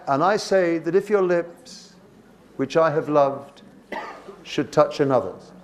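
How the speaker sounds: measured reading, pausing after "lips" and "loved"; pauses at commas. Shown as a demonstration. Teacher shows what commas are for, pausing after "lips" and "loved". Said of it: "Commas are for a psychological and a physical pause".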